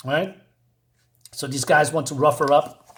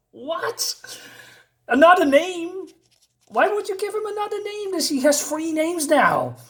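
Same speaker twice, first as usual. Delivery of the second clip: very high-pitched mock disbelief; incredulous woman; 11 semitones higher than usual. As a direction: squeal the questions in a high woman's voice. Woman reacts to another new name.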